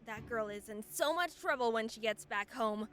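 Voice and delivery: usual speaking voice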